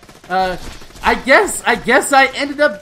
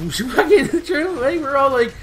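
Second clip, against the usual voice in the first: ordinary talk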